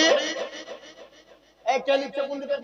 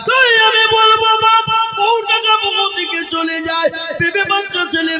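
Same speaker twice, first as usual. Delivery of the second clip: high, loud, slowed sermon cry; preaching at full force; 10 semitones higher, 5 dB louder than usual. Acted. Preacher rousing the gathering, full-force sermon cry. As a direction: hurl it over the crowd like a fiery preacher.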